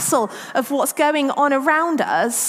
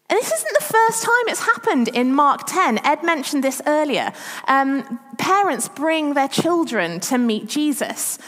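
ordinary talk, nothing marked

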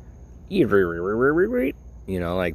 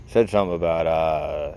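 ordinary talk, nothing marked

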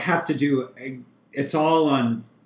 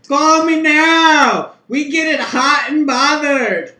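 high, slow hammed-up Jamaican accent; 14 semitones higher, 10 dB louder, slower than usual. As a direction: psychic hotline ad in a Jamaican accent. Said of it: "That's my Jamaican accent".

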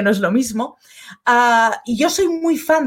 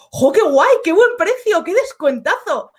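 delighted shopper; 6 semitones higher than usual. Retold or imitated. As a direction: act thrilled at a fake discount. Excited at a discount sign.

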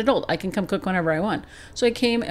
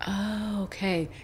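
soft, deflated oh, okay; quiet realization; 8 dB quieter, slower than usual. Retold or imitated. Host softly calms herself after the angry thought. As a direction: let the anger drain into a soft okay. Sudden self-realization, calming down.

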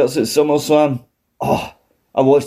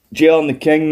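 usual speaking voice

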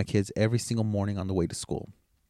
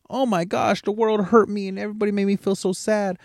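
high, whiny mock self-pity; mocking victim whine; 9 semitones higher than usual. Father mocking people with a victim mentality, high whiny mock self-pity. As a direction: sneer at the poor-me crowd in a high whine. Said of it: "We like to play this a victim mentality".

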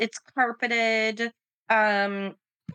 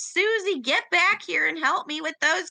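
calling out; 7 semitones higher than usual. Acted. Cook calls out to the girl.

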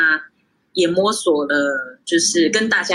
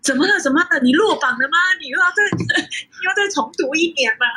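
worried mom; 11 semitones higher than usual. Retold or imitated. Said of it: "我妈还跑来问我说".

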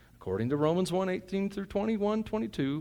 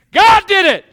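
much higher and louder, a full yell; excited shout; 16 dB louder than usual. Real, not acted. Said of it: "apologize for getting excited".

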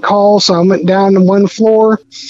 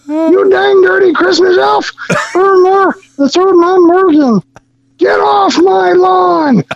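high, strained mock yell at the elf; shrieking get-off-my-lawn rant; angry neighbor yelling; 11 semitones higher than usual. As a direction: holler like a cranky old neighbor.

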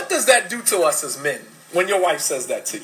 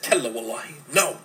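gentle denial; 7 semitones lower, 6 dB quieter than usual. Retold or imitated. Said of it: "And Khadija said".

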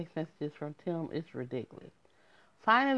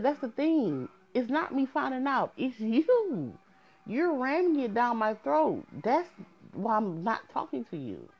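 raised pitch, pressing the point hard; heated retort; 7 semitones higher than usual. Retold or imitated. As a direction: push back, exasperated and high. Retold argument with boyfriend.

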